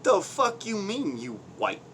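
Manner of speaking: high, sharp outraged question; mock incredulous challenge. Imitating a stranger doubting his race, mock indignant. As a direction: throw the question back in disbelief.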